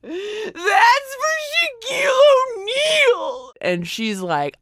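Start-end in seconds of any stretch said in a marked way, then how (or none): 0.0-3.5 very high, slow crying protest; teen refusing the huge can, shrieking; horrified teen wail. Retold or imitated. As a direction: wail it like a mortified kid. Kid horrified at deodorant.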